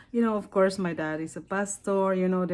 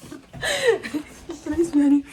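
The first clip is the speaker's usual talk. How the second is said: laughing; 5 semitones higher, slower than usual. Real, not acted. Laughing while asking again.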